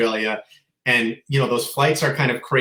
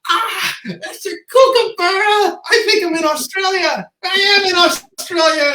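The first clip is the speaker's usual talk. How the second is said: delighted discovery, voice leaping up; overjoyed, much higher and louder; excited realization; 6 dB louder than usual. Retold or imitated. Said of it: "And I, and I was like".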